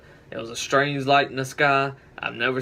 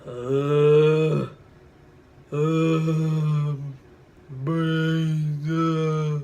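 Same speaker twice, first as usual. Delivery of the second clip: zombie impression; 3 semitones higher, much slower than usual. Shown as a demonstration. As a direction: moan like a hungry zombie. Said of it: "Zombie impression".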